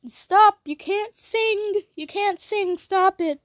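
high, pleading mock protest; exasperated plea. To her singing sister, high exasperated mock protest. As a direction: beg her to quit singing. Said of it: "and I'd be like".

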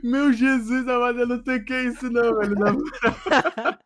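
wheezing through uncontrollable laughter; helpless laughter. Real, not acted. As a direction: gasp the words between laughs. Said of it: "O maluco tá rindo por um minuto seguido".

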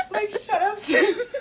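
loud, high, laughing scoff; laughing dismissal. Real, not acted. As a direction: crack up while telling her off. Laughing at the mocked celebrity.

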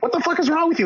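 very high mock outraged squeal; outraged crowd. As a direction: shriek it like a scandalized crowd.

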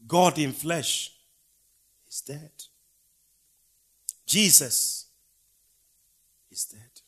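loud high cry that drops to a hush on "is dead"; preacher's rising lament. Acted. Preacher lamenting to the congregation, shouting the titles, hushing "is dead". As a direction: proclaim each title, then let it die. Building cries, then falling whispers.

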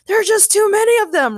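very high, loud shout. Acted. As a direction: yell it over the battle. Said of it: "Ray shouted".

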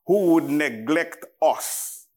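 normal talking voice

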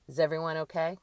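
loud, sharp call of concern. A narrator's reading. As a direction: call it out sharply to the whole group. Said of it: "Carla's voice called out deep and sharp".

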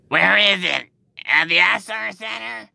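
high-pitched eager questioning; imitated woman, high and excited. Imitating the excited loud customer.

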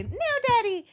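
very high mock cry to Daddy; imitating Megan's cry. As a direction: pitch it way up like a wailing daughter. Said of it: "Megan yelling at her dad".

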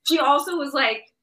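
ordinary talk

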